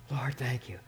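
soft private whisper to God; hushed thankful whisper; whispered prayer. Real, not acted. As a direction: breathe a quick thanks under your breath. Quick whispered prayer aside.

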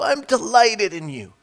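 high, loud, beaming father's voice; a father's proud blessing over his son. Acted. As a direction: call it out like a proud dad. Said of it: "It's a father saying".

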